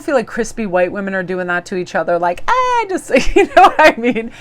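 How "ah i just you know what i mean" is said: squeaky laughing mock. Mocking stiff women's greeting.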